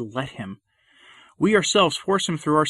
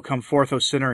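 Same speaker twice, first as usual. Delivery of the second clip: usual speaking voice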